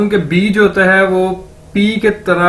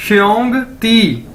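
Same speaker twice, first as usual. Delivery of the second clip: श्योंग ती said slowly, shyong ti; slow, careful teacher demo; slow drill; 3 semitones higher, slower than usual. Shown as a demonstration. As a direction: join श्योंग ती slowly for the class. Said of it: "इकट्ठा कर दें".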